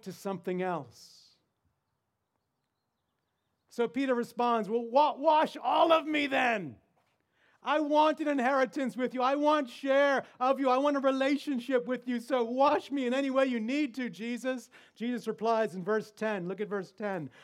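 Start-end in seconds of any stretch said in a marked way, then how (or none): 4.7-14.7 eager plea. Acted. Peter's eager plea voiced.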